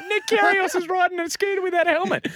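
very high, squealed mock report; panicked tattletale caller. Outraged caller dobbing to triple O, mock panic. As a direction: squeal it like a hammed-up tell-tale. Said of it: "you imagine the phone call".

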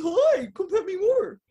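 voicing the dog. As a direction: speak as the happy dog begging for pets.

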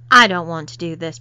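a loud, stubborn protest against the maid plan. A narrator's reading. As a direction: refuse him out loud. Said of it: "protested Ben".

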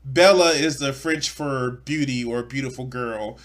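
ordinary delivery

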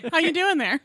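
high, loud laughing question. Real, not acted. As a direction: laugh through the words. Laughing at co-host.

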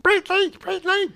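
very high squeaky creature voice; Gizmo voice. Retold or imitated. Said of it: "Howie Mandel returned as the voice of Gizmo".

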